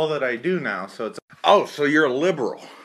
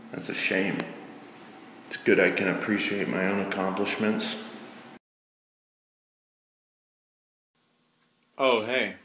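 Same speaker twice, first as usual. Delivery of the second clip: smug low voice; 6 semitones lower, 6 dB quieter than usual. Acted. Smug bathroom character.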